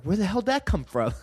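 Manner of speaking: high, incredulous question breaking into laughter; retold bafflement. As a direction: act out baffled disbelief at yourself. Retelling bafflement at his own outburst.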